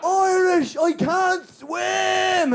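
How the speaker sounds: high, drawn-out roar for help; acted desperate long shout. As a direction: roar it across the water, stretching the words. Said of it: "And I had to roar".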